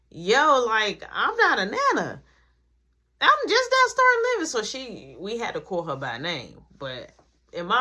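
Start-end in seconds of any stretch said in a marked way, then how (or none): 3.2-4.5 nana's voice. Retold or imitated. Nana insisting she's too young.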